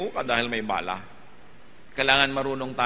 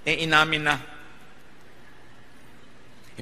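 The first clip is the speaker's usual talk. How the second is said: normal talking voice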